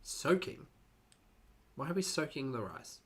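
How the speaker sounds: ordinary delivery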